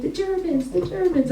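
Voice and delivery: high-pitched imitation of fawning praise; mock admiration. Comedian imitating Albanians praising the Germans, mock admiration.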